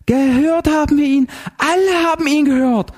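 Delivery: very high, frantic insistence; spitting retort. A narrator's reading. Zinzendorfer insisting before Heller, spitting it out. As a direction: spit it out high and wild. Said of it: "fauchte die Zinzendorfer".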